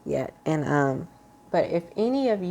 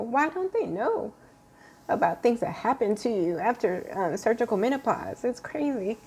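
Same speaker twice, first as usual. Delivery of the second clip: choked-up frustration, near crying; tearing up; 4 semitones higher than usual. Real, not acted. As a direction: voice cracking high with real tears. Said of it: "I don't know why I'm tearing up".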